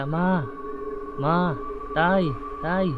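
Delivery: high, frightened calls for mother and father; a scared child calling Ma and Tay; calling for parents. Retold or imitated. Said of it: "Tinatawag ko sila".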